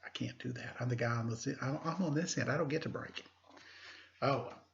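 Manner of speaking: ordinary delivery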